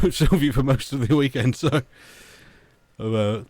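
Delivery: ordinary delivery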